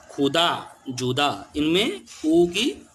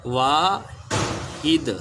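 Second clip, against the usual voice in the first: slow drawn-out word; much slower than usual. Shown as a demonstration. Teacher to young pupils, drawing the word out slowly. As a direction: stretch Wahid slowly, syllable by syllable. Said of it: "फिर है वाहिद".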